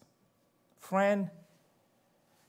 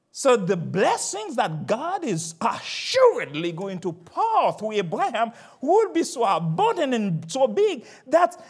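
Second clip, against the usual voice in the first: rising higher and louder; building preaching; 7 semitones higher, 7 dB louder than usual. Acted. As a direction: build toward the climax.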